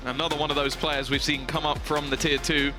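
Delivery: ordinary talk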